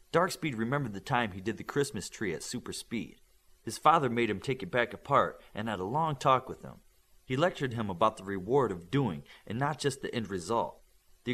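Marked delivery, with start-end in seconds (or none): none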